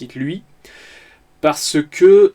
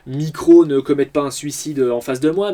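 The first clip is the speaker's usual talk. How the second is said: ordinary talk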